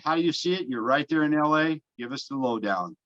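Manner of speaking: ordinary talk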